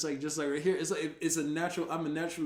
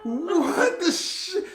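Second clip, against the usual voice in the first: mock outrage; 12 semitones higher, 9 dB louder, much slower than usual.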